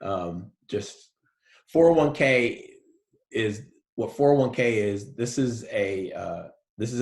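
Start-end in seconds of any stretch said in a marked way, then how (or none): none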